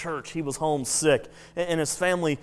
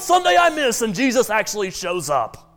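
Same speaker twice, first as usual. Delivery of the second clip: put-on high, loud childish exasperation; hammed-up little-boy outburst; upset little boy; 7 semitones higher, 8 dB louder than usual. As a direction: protest like a kid who missed out. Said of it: "upset, the little boy says".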